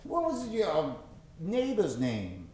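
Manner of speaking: ordinary talk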